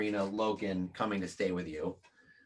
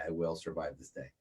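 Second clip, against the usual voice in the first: resigned murmur; 9 semitones lower, 11 dB quieter than usual. Retold or imitated. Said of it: "she's like".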